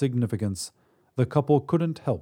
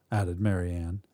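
plain reading voice

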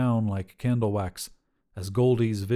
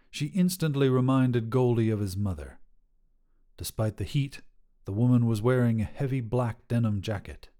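usual speaking voice

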